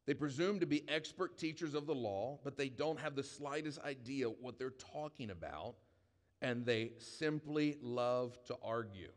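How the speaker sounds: slow, measured Bible reading with long pauses; scripture reading. Acted. Preacher to congregation, reading First Timothy slowly. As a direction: read the verses deliberately, letting each phrase land.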